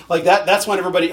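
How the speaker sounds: usual speaking voice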